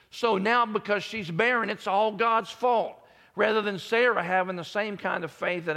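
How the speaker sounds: high, loud preaching build; full-force preaching. Acted. Preacher urges the congregation to trust God, full force. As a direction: build to a rousing declaration.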